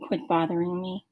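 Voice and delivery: low, soft and sullen; very quiet. A narrator's reading. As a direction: say it under your breath. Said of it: "Jeff said very quietly".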